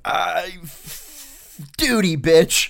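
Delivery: high, loud mock shout; imitated outburst. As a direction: yell it as a fed-up superior. Imitates superiors' outburst in retold argument.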